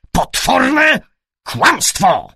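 loud, high outraged cry; enraged shout. A narrator's reading. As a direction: explode at the accusation. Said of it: "krzyknął". (wściekły, wysoki krzyk)

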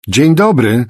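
loud, high call into the rooms; shouted greeting. A narrator's reading. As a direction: shout hello to someone unseen. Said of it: "krzyknęła".

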